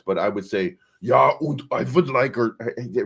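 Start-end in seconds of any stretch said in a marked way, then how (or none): 1.0-2.4 higher, clumsy fake Russian accent; bad Russian accent. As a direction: attempt a Russian accent, badly. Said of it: "I don't have a Russian accent".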